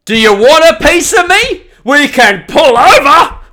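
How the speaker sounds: high, loud threatening yell; road rage challenge. Pretend. Sign taunting the tailgater, loud high threatening yell. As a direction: bark a challenge at the driver behind. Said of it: "Next one".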